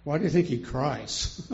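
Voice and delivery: ordinary talk, nothing marked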